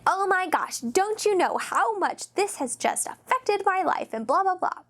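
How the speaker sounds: whiny, self-important complaint; entitled rant. Retold or imitated. Customer berating a server, mock outraged whine.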